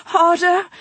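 high loud plea; beg, high and breathless; begging. A narrator's reading. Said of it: "she begged".

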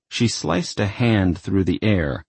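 usual speaking voice

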